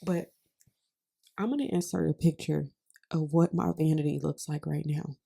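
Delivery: ordinary talk, nothing marked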